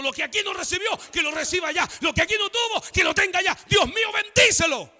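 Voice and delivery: acted plea building high and loud; impassioned prayer. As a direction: pray it with mounting fervour, ending in a shout. Acts out a pastor's prayer.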